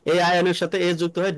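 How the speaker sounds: usual speaking voice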